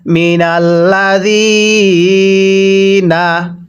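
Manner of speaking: slow, drawn-out melodic chant; Quran recitation. Shown as a demonstration. Teacher chanting the verse for students, slow melodic recitation. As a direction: stretch each vowel in a slow recitation tune.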